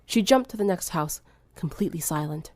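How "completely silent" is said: plain reading voice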